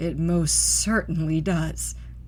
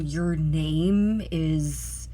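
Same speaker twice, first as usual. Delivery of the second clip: very slow, unsure; slow, wavering choice; hesitant naming; 3 semitones lower, much slower than usual. Acted. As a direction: drag the words out while you decide. Sarah hesitantly names the phone.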